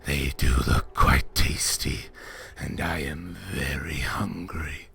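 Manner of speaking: a lazy, menacing beast savouring its prey; Manticore voice. Acted. Manticore toys with her.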